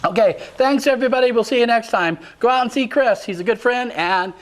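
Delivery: announcer sign-off. Acted. Host signs off again.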